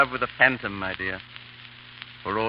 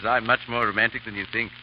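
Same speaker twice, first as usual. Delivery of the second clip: ordinary talk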